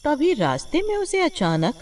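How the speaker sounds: usual speaking voice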